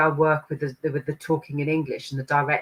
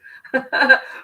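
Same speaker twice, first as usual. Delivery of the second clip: high, loud burst of laughter; 10 semitones higher, 6 dB louder than usual. Real, not acted. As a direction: laugh out loud between questions. Amused while relaying the message.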